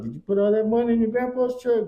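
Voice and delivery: high, teasing disbelief; Father teasing his son about fixing up grandpa's truck, high mock disbelief; incredulous question. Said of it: "I was like".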